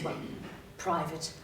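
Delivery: usual speaking voice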